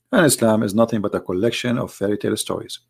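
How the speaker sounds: usual speaking voice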